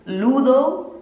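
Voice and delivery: Ludo in a Spanish accent, slow and rising, LOO-lo. Shown as a demonstration. Showing viewers how Spanish speakers say it, Spanish accent. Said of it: "like, a Spanish accent".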